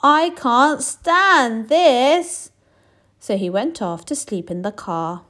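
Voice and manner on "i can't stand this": very slow, higher, louder complaint; exasperated bear. Acted. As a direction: drag it out, fed up. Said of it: "said Mister Bear".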